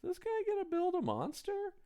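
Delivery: baffled viewer wondering aloud; mock puzzled question. Viewer wondering about the monster, a high, soft puzzled question. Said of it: "when I'm going".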